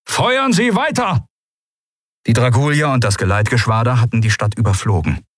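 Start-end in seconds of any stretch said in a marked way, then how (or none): 0.1-1.2 high, drawn-out command; shouted order. A narrator's reading. Admiral ordering continued fire, long high shout. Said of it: "rief der Admiral".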